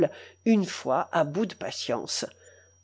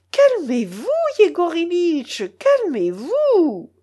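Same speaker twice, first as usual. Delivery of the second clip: higher, drawn-out plea in a woman's voice; anxious cry; 7 semitones higher, slower than usual. A narrator's reading. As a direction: soothe him with rising alarm. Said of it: "s'écria Anfissa Petrovna".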